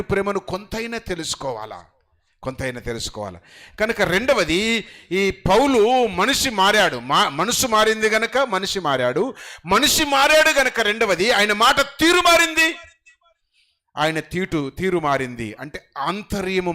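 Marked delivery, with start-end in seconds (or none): 3.8-12.8 loud, high, slow preaching build; rising sermon cry; full-force preaching. Acted. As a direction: proclaim it to the whole hall.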